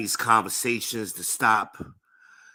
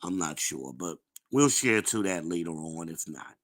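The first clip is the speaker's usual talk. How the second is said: ordinary talk, nothing marked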